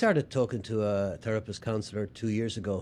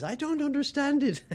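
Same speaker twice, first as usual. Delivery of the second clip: wife voice pitched much higher, mock-plaintive; Bill Burr doing his wife; 14 semitones higher than usual. Comic retelling Bill Burr's bit, imitating his wife, high and plaintive. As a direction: squeak it out like an exasperated wife. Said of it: "you can hear Bill doing his wife".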